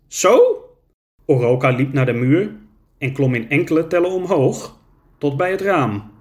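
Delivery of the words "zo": much higher, thin little creature voice; a tiny creature asking kindly; small creature voice. Acted.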